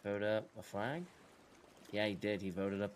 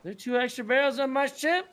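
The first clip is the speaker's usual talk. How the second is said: high, loud squeaky mock voice; 10 dB louder than usual. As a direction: squeak it out like a clueless player. Mocking the other player.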